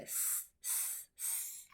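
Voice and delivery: long unvoiced sss; drawn-out s hiss; hissing s sound. Shown as a demonstration. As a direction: hiss the s like a snake. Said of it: "And a digraph".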